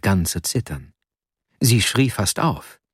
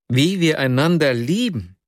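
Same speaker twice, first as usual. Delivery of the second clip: exclaimed echo; 6 semitones higher than usual. A narrator's reading. The narrator echoes Nastjenka in pained disbelief. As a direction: echo her words higher, in disbelief. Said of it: "rief ich aus".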